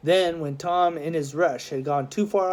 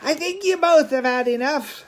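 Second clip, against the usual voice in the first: loud, high mock-authoritative call; a policeman calling out to the two fighting boys; 12 semitones higher, 5 dB louder than usual. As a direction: break up the fight with a firm call. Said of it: "loud, deep, masculine voice called out".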